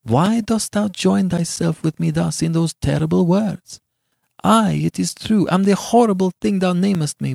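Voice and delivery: high-pitched, sorrowful appeal; grief beyond passion. A narrator's reading. Beatrice calling herself a monster to Giovanni, grieving. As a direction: plead in grief, high but controlled. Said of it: "for her grief was beyond passion".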